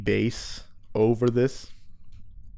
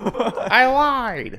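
Shaggy voice; 10 semitones higher than usual. Acted. Continuing Shaggy voice gag.

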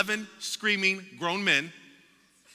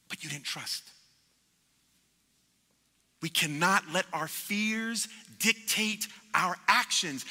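usual speaking voice

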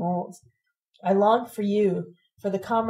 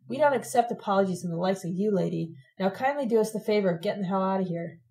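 plain reading voice

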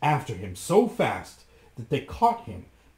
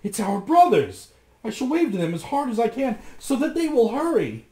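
much higher, louder, fast; joyful cry; 11 semitones higher, 7 dB louder than usual. A narrator's reading. Sister Anne crying out to the brothers, joyful. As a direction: shout it out with relief. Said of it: "she cried".

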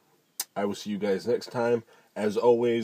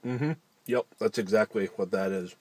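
speaking between chews; chewing while talking. Real, not acted. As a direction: mouth partly full of food. Seen 1: Bit wrap at -5s; mouth working on food while speaking.

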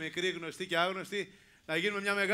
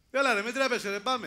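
calling out; 5 semitones higher, 7 dB louder than usual. Real, not acted. Host urges shy audience member.

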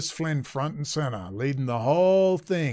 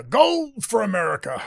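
higher excited announcer call; mock sports announcer; 7 semitones higher, 4 dB louder than usual. Speaker mimics an old Australian sports call, high and excited. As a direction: call it like an Olympic commentator. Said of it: "over fifty in Australian to understand".

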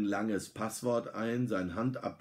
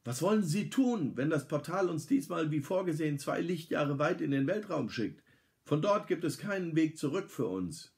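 reproachful; 8 semitones higher than usual. A narrator's reading. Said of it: "Mit vorwurfsvoller Miene".

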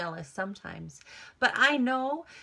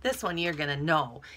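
usual speaking voice

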